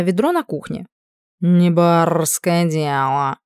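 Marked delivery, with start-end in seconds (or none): 1.4-3.4 slow haughty cat voice; snooty bored cat. Acted. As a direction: dismiss it like a lazy aristocrat. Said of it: "фыркнул кот".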